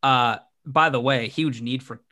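ordinary talk

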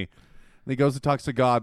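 ordinary delivery

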